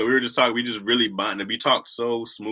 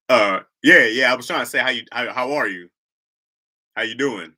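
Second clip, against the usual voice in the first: audible, clear voice after being asked; speaking up; 6 dB louder than usual. Acted. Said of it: "Can you speak up?".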